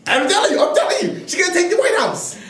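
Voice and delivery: high, laughing insistence. Real, not acted.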